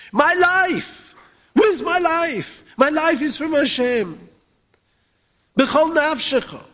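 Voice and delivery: pitched high, pleading and intense; fervent preaching. Acted. Rabbi rousing his listeners about their life, fervently preaching. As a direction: cry it out to the congregation. Rising sermon fervour.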